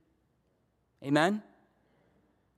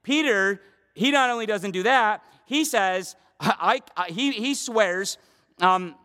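ordinary delivery

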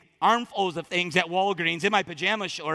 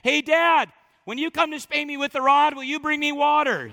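put-on high, loud cheeky child's call; punchline shouted like a kid; 8 semitones higher, 5 dB louder than usual. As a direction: yell it up with a grin. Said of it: "the boy cries again".

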